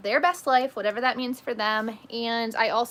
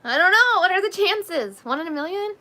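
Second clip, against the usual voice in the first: higher and louder, delighted; excited; 9 semitones higher, 7 dB louder than usual. Real, not acted. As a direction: toss it up with bright surprise. Excited reaction to chat.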